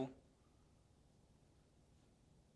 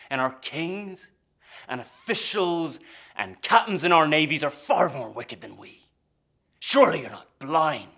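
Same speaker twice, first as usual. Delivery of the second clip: loud, raised pitch, hammering the key words; indignant tirade; 6 semitones higher, 12 dB louder than usual. Acted. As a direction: rail against the powerful, loudly. Pirate rails against rulers.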